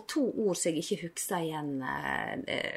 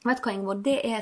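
ordinary delivery

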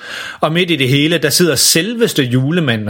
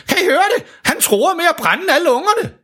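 much higher alarmed Santa; Santa shouting. Acted. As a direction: cry out in alarm.

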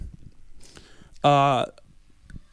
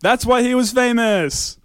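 rising, louder sports-result proclamation; mock triumphant announcement; 5 semitones higher, 5 dB louder than usual. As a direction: build to a big, high finish.

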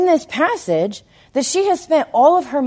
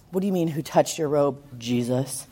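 much lower and softer, fast and dry; deadpan Thomas; 9 semitones lower, 7 dB quieter than usual. Retold or imitated. Thomas to Jesus, dry and skeptical, lower and softer. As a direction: mutter it like doubting Thomas. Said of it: "Thomas is like".

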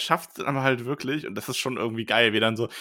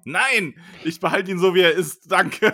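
loud protest; 7 semitones higher, 4 dB louder than usual. Acted. Harry refuses Lockhart's help.

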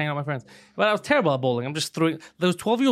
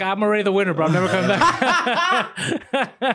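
laughing boast; 3 semitones higher, slower than usual. Retold or imitated. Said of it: "I was like".

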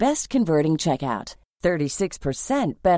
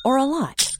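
much higher, bigger read; big excited voice; 10 semitones higher than usual. Acted. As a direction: pump it up for a lot. Ad contrasting little and a lot.

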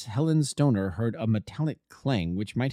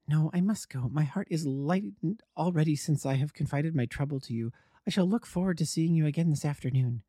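higher, lightly voiced lady visitor; young lady client; 6 semitones higher than usual. A narrator's reading. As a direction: lift the voice for the departing lady client. The lady client speaks in the tale.

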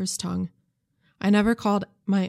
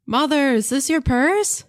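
high, louder shout across the sanctuary; 7 semitones higher, 5 dB louder than usual. A narrator's reading. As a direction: call out as loud as you can. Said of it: "shouted my loudest voice".

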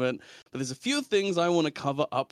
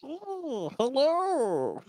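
high squeaky cockroach voice; imitating Squeeb; 11 semitones higher, much slower than usual. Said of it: "is it a funny voice to practice".

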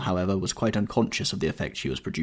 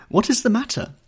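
put-on very high, louder girlish voice; exclaimed question; 6 dB louder than usual. A narrator's reading. Sister asking her mother and brother what is wrong, exclaiming. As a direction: cry it out, pitched right up. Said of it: "she cried".

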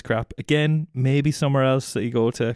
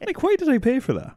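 incredulous; 13 semitones higher than usual. Real, not acted. Outraged at reflexology.

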